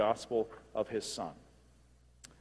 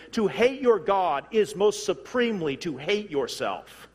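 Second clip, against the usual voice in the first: loud, high, impassioned pulpit delivery; forceful preaching; 7 semitones higher, 7 dB louder than usual. Real, not acted. Preacher warning those who resist God's sovereignty, forceful preaching. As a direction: drive each phrase home, stretching the key words.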